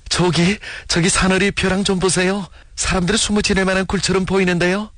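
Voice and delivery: high-pitched call to point out a find. A narrator's reading. Sharp-eyed Ri Aengdo spots a cave for the group, calling out high. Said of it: "리앵도가 소리를 쳤다".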